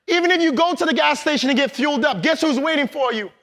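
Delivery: usual speaking voice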